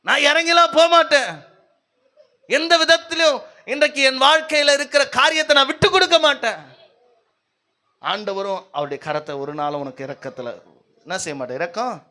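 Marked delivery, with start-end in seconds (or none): 0.1-6.6 raised, emphatic mock defiance; acted-out obstinate protest; stubborn refusal. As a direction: dig in and refuse, hammed-up for the congregation. Acts out a stubborn person refusing.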